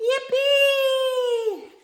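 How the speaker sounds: high, loud yippee; gleeful cheer. Acted. Bugs arriving at the beach, cheering high and loud. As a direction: cheer like an excited bug.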